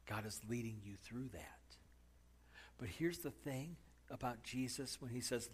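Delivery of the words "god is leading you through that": hushed aside to the congregation; whisper. Real, not acted. Preacher whispering an aside to the congregation. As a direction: drop to a breathy whisper.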